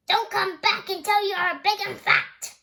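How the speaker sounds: troll shout. Acted. Troll warns the goat off his bridge, shouting. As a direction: bellow the warning like a troll. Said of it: "he shouted".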